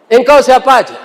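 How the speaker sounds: high, loud call down the well; a husband shouting at the stranger below; startled husband calling. Acted. (suami berteriak kaget ke dalam sumur)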